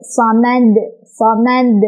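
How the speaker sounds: "samad" with a strong echoing release on the final d; big bounced D. Shown as a demonstration. Teacher shows big qalqalah at a stop, bounced "samad". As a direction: bounce the last d of "samad" clearly. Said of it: "memantul dengan besar pantulannya".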